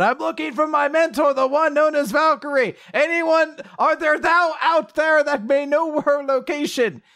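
high, loud theatrical proclamation; shouting into a crowd. Acted. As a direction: hail the whole crowd in a high, strained call. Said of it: "shouts out into the crowd".

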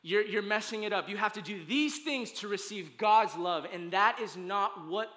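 loud, high, urgent preaching over music; full-force preaching. Acted. Pastor urging the congregation over music, full-force preaching. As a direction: drive it home to the church like a climax.

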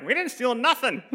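high-pitched indignant grandma; grandma's protest. Retold or imitated. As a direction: imitate grandma's comic, outraged denial. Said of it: "Grandma's like".

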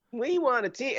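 high-pitched mock plea; mock clamouring. Host repeats the cities' plea for a team, mock clamouring. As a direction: pipe it up high like an eager city.

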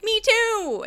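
high, loud, eager agreement; mock enthusiasm. One avoidant agreeing with another, mock enthusiasm.

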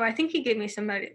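ordinary delivery

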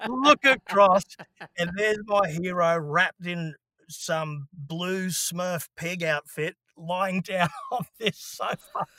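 can barely get it out for laughing. Real, not acted. Laughing through the story.

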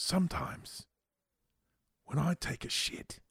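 soft, halting British impression; imitating a musing British friend; British accent. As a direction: confide it quietly in a British accent, with pauses. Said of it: "he's got that British accent".

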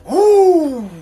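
sharp high loud whoop of alarm. Retold or imitated. Driver hydroplaning sideways, a high loud whoop. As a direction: let out a wild whoop as it slides. Truck hydroplaning, retold yell.

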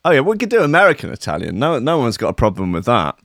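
ordinary talk

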